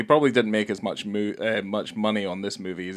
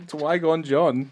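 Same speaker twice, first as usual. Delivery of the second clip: Dwygon John said slowly, laughing, a bit higher; laughing mock name; 6 semitones higher, slower than usual. Laughing at the made-up director name, Dwygon John drawn out. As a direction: giggle through Dwygon John as a silly title. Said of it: "Dwygon John's".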